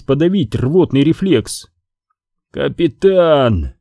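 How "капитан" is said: slow wet gurgle; gurgling voice. Acted. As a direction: gurgle it out, very slowly. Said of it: "пробулькало с другой стороны". (медленный булькающий голос)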